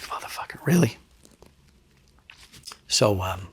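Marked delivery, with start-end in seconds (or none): 0.0-1.0 breathed, almost voiceless whisper; disbelieving whisper. Retold or imitated. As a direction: hiss it under your breath in disbelief. Disbelief watching the evidence video.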